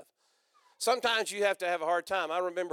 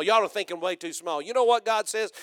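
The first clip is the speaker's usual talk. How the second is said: ordinary delivery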